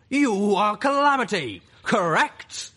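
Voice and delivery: loud, high, slow booming question; thunderous monster voice. A narrator's reading. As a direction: bellow each word like an earthquake. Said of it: "its voice like an earthquake filled with knives".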